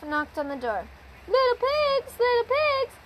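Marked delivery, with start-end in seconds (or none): 1.3-2.9 high sly wolf calling. A narrator's reading. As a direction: coax the pigs like a sneaky wolf. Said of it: "said the wolf".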